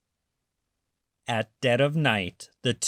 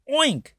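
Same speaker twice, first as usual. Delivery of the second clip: very high, louder oink; cats oinking; 12 semitones higher, 3 dB louder than usual. A narrator's reading. Cats trick the thieves, a high pig oink. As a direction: squeal like a pig. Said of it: "said the cats".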